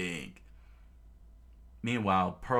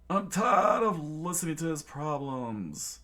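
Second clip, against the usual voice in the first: lightly voiced villain; 7 semitones higher, 5 dB louder than usual. Bored villain voice.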